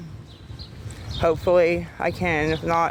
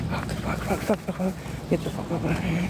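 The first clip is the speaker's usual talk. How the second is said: cursing under the breath, softer; muttered cursing; 6 dB quieter than usual. Real, not acted. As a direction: swear quietly to yourself in frustration.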